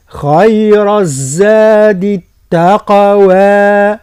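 very slow, drawn-out tajweed recitation; Quran recitation. Shown as a demonstration. Teacher models the verse for students in slow recitation. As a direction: chant it slowly with long vowels. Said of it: "هكذا".

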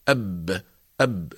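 each "أب" stopped dead on a vowelless b; consonant with sukoon. Shown as a demonstration. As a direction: close the lips on the b of "أب". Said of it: "السكون التصاق الشفة العليا". (نطق "أب" بباء ساكنة والشفتان ملتصقتان)

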